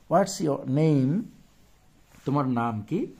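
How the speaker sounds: ordinary talk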